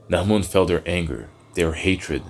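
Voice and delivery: usual speaking voice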